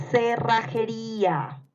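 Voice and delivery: slow, drawn-out "Cerrajería"; dictation. Shown as a demonstration. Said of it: "les voy a ir dictando". (dictado lento, sílaba por sílaba)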